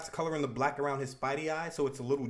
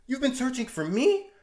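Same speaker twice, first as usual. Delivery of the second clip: high, loud, stunned reply; shocked; 7 semitones higher, 6 dB louder than usual. Acted. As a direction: gasp it out in disbelief. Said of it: "She replies in shock".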